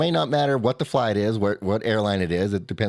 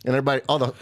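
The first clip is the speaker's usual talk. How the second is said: ordinary talk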